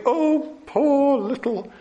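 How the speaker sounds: high, slow, sing-song mock sympathy; mock pity. Preacher mocking the congregation's pity, high and slow. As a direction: coo over the poor little tree, half laughing. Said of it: "we read this and we think".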